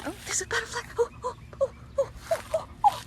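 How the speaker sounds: high, squealing delight at a butterfly; excited. Real, not acted. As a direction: squeal with thrill. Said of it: "excited about the butterfly".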